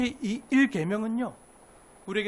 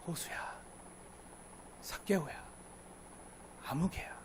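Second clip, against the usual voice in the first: softer gentle calling of names; tender whisper; 3 semitones lower, 12 dB quieter, slower than usual. Acted. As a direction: call each name softly and lovingly. Jesus calling names, hushed.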